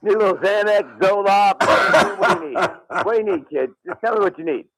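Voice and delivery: high-pitched comic doctor voice; hammed-up high voice, offering pills; imitating the doctor. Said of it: "He's like".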